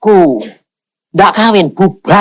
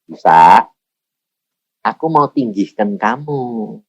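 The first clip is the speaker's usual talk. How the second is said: lower, softer, slow voice of God; lightly voiced God speaking low and softly; God's voice; 6 semitones lower, 3 dB quieter, slower than usual. God promising the preacher to lift him up, low and soft. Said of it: "Ngomongnya gini".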